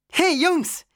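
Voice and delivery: high, lively call to friends; high-pitched excited hail. A narrator's reading. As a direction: call out brightly to get their attention. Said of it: "rief Sarah".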